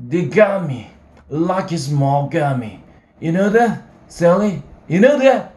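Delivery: put-on drunk shouting. Drunk Holden raving.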